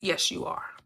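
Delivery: a secret whispered yes from inside; whispered affirmation. Pretend. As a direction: whisper it like the subconscious agreeing. Voicing the subconscious answering.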